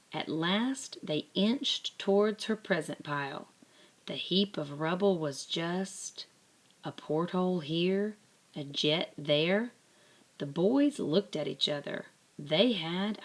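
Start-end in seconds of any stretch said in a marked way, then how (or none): none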